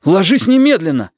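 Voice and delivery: high, insistent command; exasperated boy insisting; urgent order. A narrator's reading. Boy ordering his grandmother to bed.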